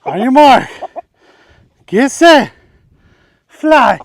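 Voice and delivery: high, loud launch call; starter's countdown shout. Pretend. Countdown to release the rooster.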